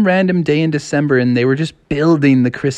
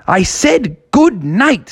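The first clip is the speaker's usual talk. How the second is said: stern sister; 8 semitones higher, slower than usual. Acted. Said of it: "And she said".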